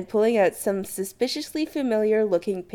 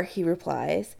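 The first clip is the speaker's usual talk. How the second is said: ordinary delivery, nothing marked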